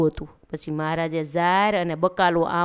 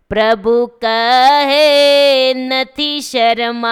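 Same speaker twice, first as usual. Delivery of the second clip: dramatic quote; 6 semitones higher, 6 dB louder, much slower than usual. A narrator's reading. Quoting Maharaj dramatically.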